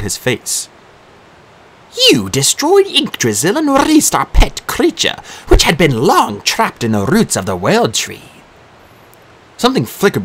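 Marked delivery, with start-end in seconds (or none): 2.1-8.3 a hint of a high, cold accusing voice; lightly voiced Disir. A narrator's reading. Disir telling Dee he freed their creature, lightly voiced. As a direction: lay the blame on him coolly.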